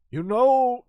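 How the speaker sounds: high, loud mock voice; mock character voice. Retelling the man's advice, lightly hammed-up. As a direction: jump up into a silly impression. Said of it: "he was like".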